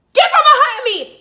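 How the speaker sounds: very loud, high shout; retold furious yell. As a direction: yell at cars behind, retelling it. Retold yelling at cars behind.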